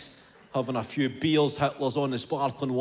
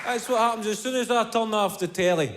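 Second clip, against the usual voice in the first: ordinary talk, nothing marked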